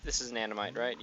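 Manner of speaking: ordinary talk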